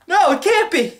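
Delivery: high, loud cry of disbelief; horrified shriek. Acted. Victim shrieks in horror at the twist. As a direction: scream in horror.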